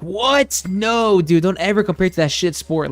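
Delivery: high, loud mock disbelief; indignant outburst, voice jumping up then easing down; imitating outraged friends. Friends scolding a newcomer for mixing up NASCAR and F one, outraged. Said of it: "And they're like".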